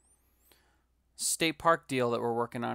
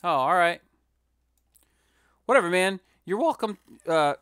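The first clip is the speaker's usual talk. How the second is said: mock exasperated; 6 semitones higher, 9 dB louder than usual. Answers chat in mock exasperation.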